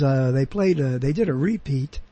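normal talking voice

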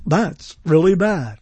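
slow, low, deadpan verdict; fake cart sound. Shown as a demonstration. Radio host analoging his sound cart, slow and low. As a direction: play back the canned line slowly. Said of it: "imitating the sound of a cart".

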